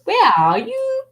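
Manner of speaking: put-on high, slow singsong little-boy call; child calling out. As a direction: mimic a small child searching, stretch each call out. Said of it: "if he's looking for something".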